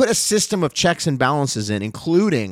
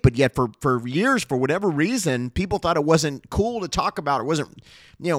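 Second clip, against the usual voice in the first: fiery higher-pitched sermon; preaching; 5 semitones higher than usual. Acted. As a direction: preach it with rising fervor. Preaching to congregation at full force.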